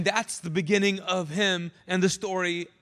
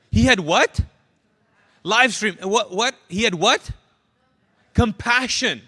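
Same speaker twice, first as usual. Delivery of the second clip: call and response; 9 dB louder than usual. Real, not acted. Preacher calling congregation to answer.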